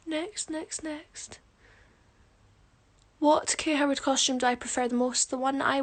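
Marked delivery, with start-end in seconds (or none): 0.1-1.4 soft, higher-pitched muttering to oneself; quiet murmur. Real, not acted. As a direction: skim past under your breath. Murmurs while scrolling through questions.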